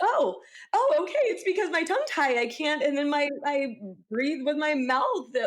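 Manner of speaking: very high, sudden realization; the penny drops; realizing. Retold or imitated. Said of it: "And I'm like".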